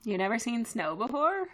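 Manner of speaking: ordinary delivery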